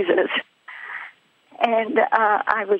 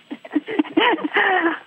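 real laugh; 9 semitones higher than usual. Real, not acted. Laughs after her own thought.

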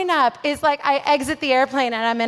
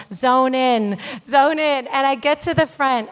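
ordinary delivery

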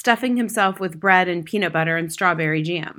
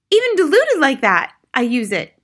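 mother; 7 semitones higher, 8 dB louder than usual. A narrator's reading. Mother keeps alarming her husband.

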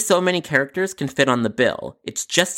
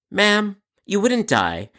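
slow patronizing reply; 7 semitones higher, slower than usual. Acted.

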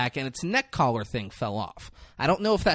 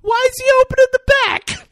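loud, very high exasperated question; incredulous yelling; 9 dB louder than usual. Acted. Baffled viewer questions the man opening the ambulance, yelling. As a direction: screech it in mock outrage. Mock outrage at film scene.

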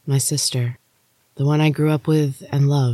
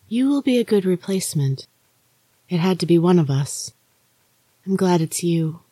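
Maria's ghostly voice; 4 semitones higher than usual. Acted. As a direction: lift it and soften it, an eerie sister warning. Maria speaks in the story.

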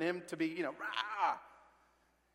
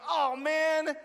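delighted exclamation; 8 semitones higher, 6 dB louder than usual. Real, not acted. Exclaiming over the verse.

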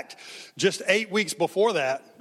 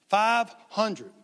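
ordinary talk, nothing marked

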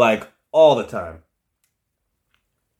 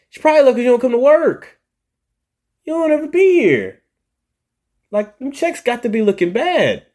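very high, loud mock rant; unfiltered scolding; 14 semitones higher, 5 dB louder than usual. Boss imagining what he wanted to tell absent employee, mock scolding. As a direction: let the exasperated inner voice squeal out. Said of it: "in my right mind, I wanted to say".

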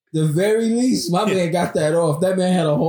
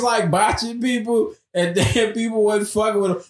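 ordinary talk, nothing marked

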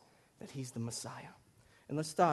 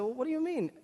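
puzzled; 10 semitones higher, 3 dB louder than usual. Retold or imitated. Himself puzzled, voiced high.

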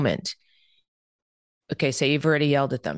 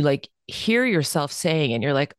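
usual speaking voice

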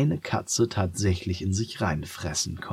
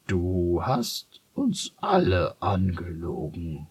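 muttering donkey; slower than usual. Acted. The donkey to the lying cat, slow grumbling mutter. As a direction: grumble it in hurt disappointment. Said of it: "murmelte der Esel".

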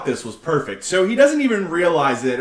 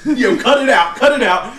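raised, higher, laughing scold; mock stern scold; 6 semitones higher, 6 dB louder than usual. Teammate telling Roderick to cut it out, mock stern. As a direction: bark it at a teammate, half laughing. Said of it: "looks at him like".

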